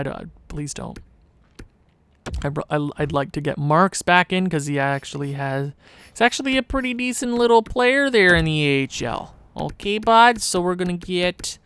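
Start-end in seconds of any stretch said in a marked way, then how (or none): 6.1-11.6 higher-pitched, put-on Canadian accent. Gamer rating a player, put-on Canadian accent. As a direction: slip into a playful Canadian accent. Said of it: "Why am I doing a Canadian accent?".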